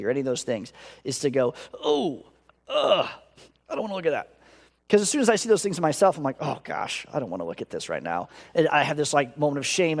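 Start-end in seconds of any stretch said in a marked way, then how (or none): 1.7-4.3 high, pulling-away ugh; a flinch of distaste; voicing his own reluctance to look; disgusted recoil. 6.4-8.3 whispered, low, under his breath; a sinking mumble to himself; low muttered reluctance; muttered dread. Retold or imitated. Inner reaction to seeing sin.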